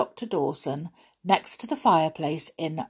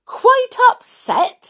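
high, loud, drawn-out outrage; angry cry; 9 dB louder, slower than usual. A narrator's reading. Mouse throwing the doctor's words back at him, angry. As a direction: cry it back slowly, much higher and louder. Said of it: "Olivia cried angrily".

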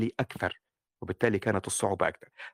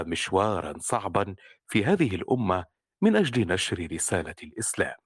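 low, steady, sober storytelling; calm adult narration; 5 semitones lower than usual. Shown as a demonstration. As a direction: read it evenly in one calm tone. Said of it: "ستكون هكذا".

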